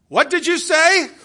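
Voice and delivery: put-on loud, high, slow old man's holler; loud old man asking again; deaf old man. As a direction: bellow like a hard-of-hearing old man. Deaf old man asks loudly.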